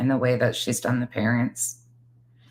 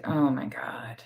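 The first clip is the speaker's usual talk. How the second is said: hushed whisper; 7 dB quieter, slower than usual. Real, not acted. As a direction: breathe it out in shock. Whispered reaction to disturbing post.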